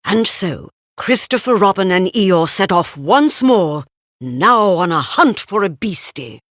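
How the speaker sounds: ordinary delivery, nothing marked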